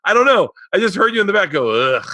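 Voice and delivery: high, loud, flustered excuse; dad explaining himself to his daughter; flustered defensive dad. Retold or imitated. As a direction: defend yourself in a squeaky rush.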